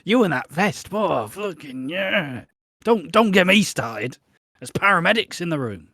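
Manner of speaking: mock flustered lust, voice swooping up; horny. Patient ogling a partner despite paramedics in the room, hammed-up horny. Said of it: "they get a bit horny for a little bit".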